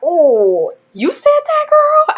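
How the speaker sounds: imitating him, very high and loud; hammed-up scandalized delight; the mentor, amazed she really said it; animated mentor. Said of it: "he is very, like, animated".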